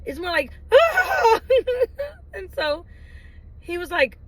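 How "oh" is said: loud, very high mock wail; high exasperated cry, hammed up; frustrated crying, mimicked. Shown as a demonstration. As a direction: act out a burst of frustrated tears. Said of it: "I'm crying frustrated tears".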